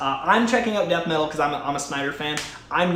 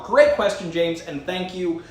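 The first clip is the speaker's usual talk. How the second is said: normal talking voice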